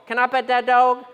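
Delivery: a hint of an eager Appalachian child; little girl begging to pet the bear. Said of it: "cute video of the Appalachian girl".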